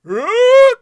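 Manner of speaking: very high, loud mocking Huh; imitating New York crowd noise; mock crowd grunt. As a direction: ape the crowd's yell. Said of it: "They're like".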